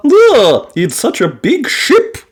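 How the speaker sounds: gentle granny wonder; Granny Pig voice. Acted. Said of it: "said Granny Pig".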